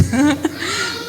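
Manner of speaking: a quick real chuckle; brief amused laugh; short laugh. Real, not acted. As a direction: laugh lightly at the thought. Laughing at son's unruliness.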